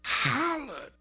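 drawn-out, high holler on the word. Retold or imitated. Preacher acting out Peter's grief, hollered. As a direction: holler it as Peter would in his pain. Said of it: "he hollered".

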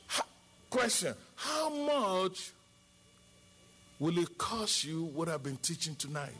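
usual speaking voice

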